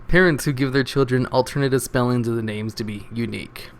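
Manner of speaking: normal talking voice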